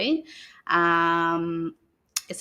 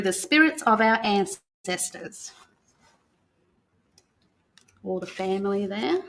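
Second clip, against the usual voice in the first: put-on reading to children. [name] reads the Welcome to Country book to the children, in a calm storytelling voice. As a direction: read it aloud like a welcome ceremony to young listeners.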